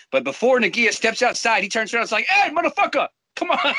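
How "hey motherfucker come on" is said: taunting call. Retold or imitated. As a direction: call them out, high and cocky. Nagia taunts friends from the door.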